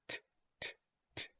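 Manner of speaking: short crisp t taps; t sound. Shown as a demonstration. Teacher sounding t for the class. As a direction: tap out the t sound. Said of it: "you know that sound".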